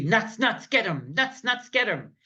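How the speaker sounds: chanted mini pep talk, pitch bobbing; light, rhythmic squirrel rallying cry; pumping themselves up. Acted. Squirrels up in the tree before starting their day, pumping themselves up. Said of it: "squirrels must first pump themselves up".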